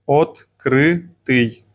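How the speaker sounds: Открытый drawn out very slowly, each syllable separate. Shown as a demonstration. Teacher models Открытый for learners, very slowly. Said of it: "Practicing the letter ы".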